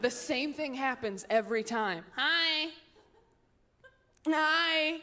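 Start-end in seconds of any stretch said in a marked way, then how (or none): 2.2-5.0 put-on high, sustained baby hello; waving baby. Baby waves at stranger from stroller, insistently chirpy. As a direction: repeat a one-word baby greeting, pitched up. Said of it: "those babies that wave at you".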